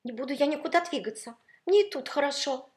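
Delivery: high, loud, petulant refusal; whiny, headstrong insistence; stubborn and capricious. A narrator's reading. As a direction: dig in like a spoiled prima donna. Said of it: "упрямо твердил капризный перелив".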